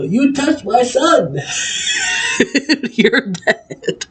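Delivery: high, slow mock yell of an old wrestler, broken by laughter; yelling old geezer. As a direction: imitate an old man's drawn-out threat, cracking up. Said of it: "the old geezer yells".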